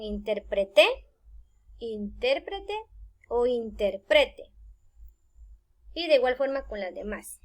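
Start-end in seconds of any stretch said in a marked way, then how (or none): 0.0-0.9 interpreté as in-ter-pre-TÉ; stress on last syllable. Shown as a demonstration. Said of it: "Asimismo con". 1.8-2.7 intérprete as in-TÉR-pre-te; accent TÉR in intérprete; stress on second syllable. Shown as a demonstration. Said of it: "Asimismo con". 3.5-4.5 slow interprete, as in-ter-PRE-te; stress on next-to-last syllable. Shown as a demonstration. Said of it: "Asimismo con".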